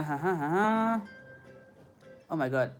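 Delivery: usual speaking voice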